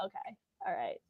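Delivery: grumpy bookseller's soft, grudging mumble. Retold or imitated. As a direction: sigh it out like a reluctant helper. Imitating coworker on grumpy duty.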